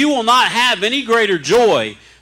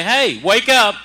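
wake-up call; 5 semitones higher, 4 dB louder, slower than usual. Real, not acted. Preacher calling the flock awake.

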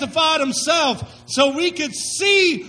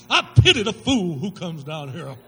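much lower, tough-guy growl as a taunt; Mister T impression; 9 semitones lower than usual. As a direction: do a deep, swaggering Mister T line. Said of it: "Reminded me of Mister T".

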